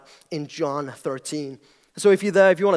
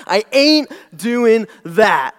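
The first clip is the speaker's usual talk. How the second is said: slow, loud Southern drawl, pitched up; southern accent; 5 semitones higher, 8 dB louder, much slower than usual. Shown as a demonstration. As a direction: stretch it out in a full Southern accent. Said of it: "conjuring my southerner spirit".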